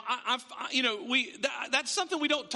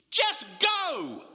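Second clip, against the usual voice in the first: higher, sudden exasperated outburst; exasperated command; 5 semitones higher, 4 dB louder than usual. Acted. God fed up with Moses' excuses, exasperated outburst. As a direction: cut off the excuses with a raised voice.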